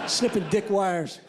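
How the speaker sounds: ordinary delivery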